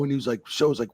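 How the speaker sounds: ordinary talk, nothing marked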